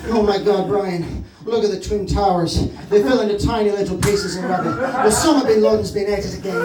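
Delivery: usual speaking voice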